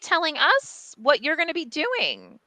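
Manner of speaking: indignant, high-pitched retort; retold outrage, pitch jumping up in disbelief; losing it. Publisher telling the publicist it is her meeting, indignant. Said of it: "I lost my shit".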